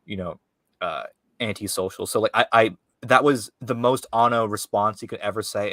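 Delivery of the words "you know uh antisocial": normal talking voice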